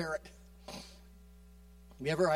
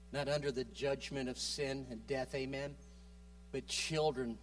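ordinary talk

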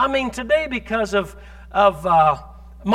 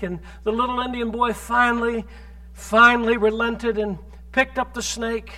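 ordinary delivery

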